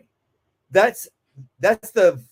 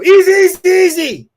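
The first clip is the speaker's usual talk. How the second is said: much higher and louder, urgent "Easy, easy"; panicked easy; 13 semitones higher, 13 dB louder than usual. Shown as a demonstration. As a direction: shout it like something is wrong. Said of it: "I'm communicating panic".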